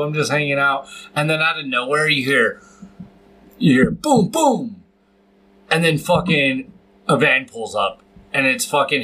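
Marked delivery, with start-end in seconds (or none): none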